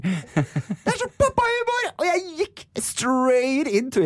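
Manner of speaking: high gleeful squeal at own joke; squealing delight. Real, not acted. Laughing at own dad joke.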